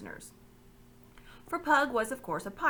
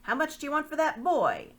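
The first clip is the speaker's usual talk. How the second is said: higher, louder lordly demand; haughty lord; 8 semitones higher, 8 dB louder than usual. Acted. Lord asking Pug the price of Caspian, haughty. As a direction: ask the price like a buyer above it all.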